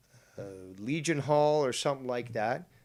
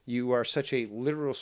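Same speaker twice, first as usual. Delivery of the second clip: ordinary talk, nothing marked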